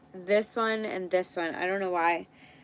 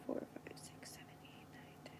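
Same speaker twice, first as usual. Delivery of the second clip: counting softly under breath, no voice; whispered counting; 23 dB quieter than usual. Real, not acted. Counting nail tips in a breathy whisper to herself. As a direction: whisper the numbers to yourself while sorting.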